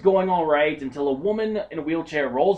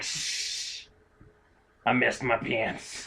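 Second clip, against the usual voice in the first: put-on hushed shh then slow low frail old woman; imitating the old lady's secret; 3 semitones lower, 7 dB quieter, much slower than usual. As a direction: shush then confess like a granny. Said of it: "our old ladies sound almost exactly alike".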